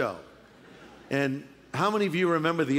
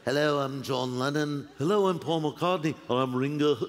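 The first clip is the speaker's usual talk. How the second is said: put-on Liverpool accent; 5 semitones lower than usual. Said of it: "they speak like this".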